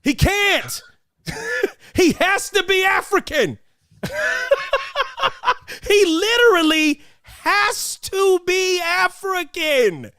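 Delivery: high, drawn-out protest breaking into laughter; slow high-pitched insistence, cracking up between phrases; laughing rant. Real, not acted. Ranting to co-hosts about Denzel in Gladiator, laughing and incredulous.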